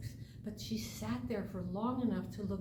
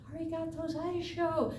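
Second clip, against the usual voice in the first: shouting; 8 semitones higher than usual. Acted. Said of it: "turning and shouting".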